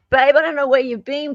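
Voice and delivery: normal talking voice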